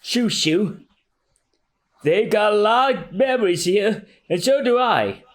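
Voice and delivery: put-on slow, high old man remembering; old mayor reminiscing. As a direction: tell it fondly, slowly. S1 voices mayor.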